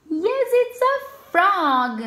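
usual speaking voice

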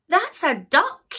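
high-pitched, protesting little voice; indignant Sarah. Acted. Sarah telling the pony it's the wrong bear, indignant. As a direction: object in a high voice.

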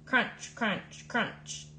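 breathy crunch, crunch, crunch like footsteps in snow; step-by-step crunching sounds; crunchy sound words. A narrator's reading. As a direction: mimic crunching snow with each word.

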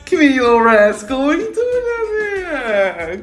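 high cooing pet voice. Real, not acted. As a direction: coo at a tiny animal. Baby talk to pet.

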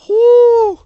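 high, loud whoop of relief; startled whoop. Real, not acted. Near miss at toll.

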